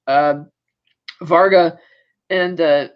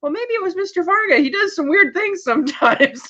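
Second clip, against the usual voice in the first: higher mock voice of a nervous secretary; 10 semitones higher than usual. Secretary telling Emmett it was Varga, in a mock high voice. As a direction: play the flustered secretary, pitched up.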